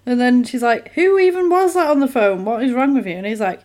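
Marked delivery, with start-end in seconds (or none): 0.9-3.1 high, indignant mock questioning; mock exasperated wife. Wife challenges husband about phone call, mock exasperated. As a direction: start high and come down, imitating exasperation.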